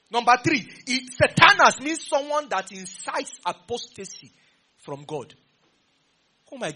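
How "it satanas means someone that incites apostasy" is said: higher, louder and slow; emphatic definition. Real, not acted. Preacher defines Satanas to the congregation, slow and loud. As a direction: spell it out, slowly and loud.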